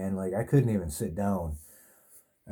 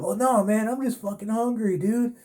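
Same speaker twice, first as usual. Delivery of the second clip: much higher, cartoonish drunk whine; mock drunk guy; 12 semitones higher than usual. Drunk inmate admits he's just hungry to the cops, high hammed-up whine. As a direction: ham up a pushy drunk demanding food.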